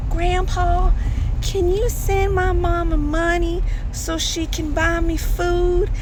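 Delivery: put-on high, slow, pleading child; a small grandchild begging grandpa for money; little kid's voice. Grandkid asks on the phone.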